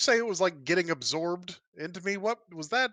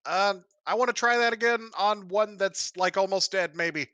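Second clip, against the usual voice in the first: lightly raised, higher in-character call; 6 semitones higher, 4 dB louder than usual. Acted. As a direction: call it out to the party, a touch tentatively. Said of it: "I will call out after that".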